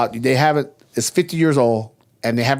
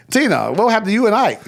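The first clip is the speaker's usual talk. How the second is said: mock plea; 8 semitones higher than usual. Host teases [name], a mock high plea. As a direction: tease her with a raised voice.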